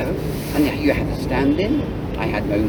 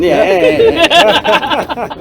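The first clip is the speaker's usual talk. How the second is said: much higher, louder yeahs through real laughter; laughing; 13 semitones higher, 6 dB louder, much slower than usual. Real, not acted. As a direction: let the yeahs ride on a big laugh. Laughing with the host.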